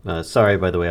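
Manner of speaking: ordinary delivery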